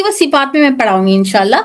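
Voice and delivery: ordinary talk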